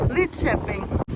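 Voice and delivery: Lidköping as "lit-chapping", K softened to ch; Lidköping, soft "ch" middle, falling end; local pronunciation. Shown as a demonstration. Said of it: "how does K become Sh?".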